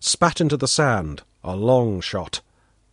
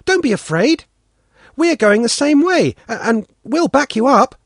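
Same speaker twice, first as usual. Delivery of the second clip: lightly voiced girl, higher; coaxing young girl; friendly girl urging a boy along; girl's voice; 11 semitones higher, 4 dB louder than usual. A narrator's reading. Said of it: "she says".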